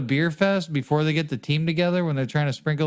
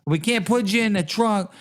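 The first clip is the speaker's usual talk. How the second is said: higher-pitched comic wiseguy act; mock mobster; 4 semitones higher than usual. Mobster explaining the body in the trunk, hammed-up gangster voice. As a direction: play a mob goon explaining himself.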